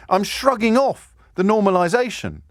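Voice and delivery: normal talking voice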